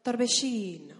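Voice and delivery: "torbellino" loud, long stressed i, ll as sh; stretched Argentine sh. Shown as a demonstration. Model for saying it to your neighbour, stretched sh. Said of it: "que le digas a la persona".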